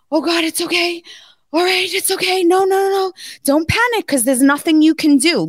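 panicky self-talk. Retold or imitated. Snorkeling tourist calming herself at the sea bottom, panicky. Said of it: "I'm sitting there and I'm going".

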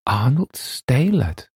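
slow, high-pitched disbelieving echo; amazed. A narrator's reading. Narrator repeats the editor's name back, amazed.

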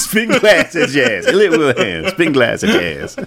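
high-pitched mock sportscaster. Co-host echoing the movie's catchphrase, high sportscaster impression. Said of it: "sportscaster voice he does in that".

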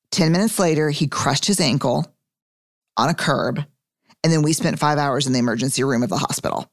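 ordinary talk

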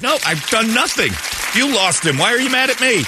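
mock exasperated defense; exasperated protest. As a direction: argue back, baffled and wronged. Arguing with mother.

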